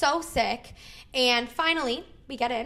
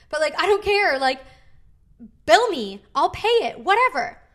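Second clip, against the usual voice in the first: exasperated outburst; 5 semitones higher, 6 dB louder than usual. Retold or imitated. Venting about the clinic bill.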